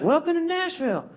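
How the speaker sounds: high, lightly voiced Southern clerk; Southern accent. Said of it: "the guy at the front was like".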